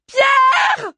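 very high, loud drawn-out yell; full-force scream. A narrator's reading. Woman trapped in fire calling Pierre, all-out scream. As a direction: scream the name as hard as you can. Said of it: "hurla plus fort Eléa".

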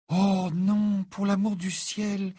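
high, drawn-out exclamation; dismayed cry. A narrator's reading. Hermione reading the letter, high cry of dismay. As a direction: cry out in exasperated dismay, pitched right up. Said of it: "s'écria-t-elle".

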